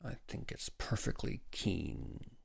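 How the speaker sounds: slow, hushed, tightly held; taut. A narrator's reading. Father answers the severe doctor, tautly. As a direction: hold it back, soft and drawn out. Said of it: "said Welling tautly".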